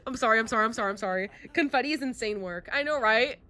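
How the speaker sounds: ordinary talk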